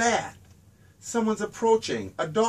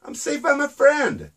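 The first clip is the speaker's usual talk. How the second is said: excited crow calls; 8 semitones higher than usual. Acted. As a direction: call out with relief and joy. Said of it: "Joe called again and again".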